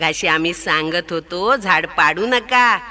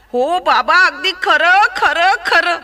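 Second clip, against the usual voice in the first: higher, louder eager assurance; eager promising elephant; 9 semitones higher, 5 dB louder than usual. Acted. Elephant eagerly assuring the sparrow it's really true. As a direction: swear it's true, begging.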